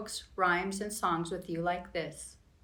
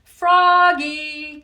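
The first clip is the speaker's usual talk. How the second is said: much louder, high call of the name; teacher calling; 7 semitones higher, 12 dB louder than usual. Acted. Teacher calling the staring frog, loud and high. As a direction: call him across the classroom. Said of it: "called his teacher".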